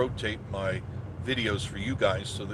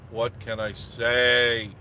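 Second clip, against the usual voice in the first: slow, louder, drawn out; drawn-out shrug; 6 dB louder, much slower than usual. Real, not acted. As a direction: stretch it out with a shrug. Repeated phrase, drawn out.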